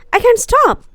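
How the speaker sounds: loud high sobbing cry; a sick boy sobbing through a tantrum; sobbed. A narrator's reading. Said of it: "sobbed Colin".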